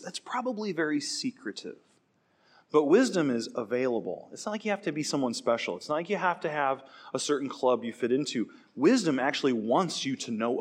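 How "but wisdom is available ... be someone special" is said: ordinary talk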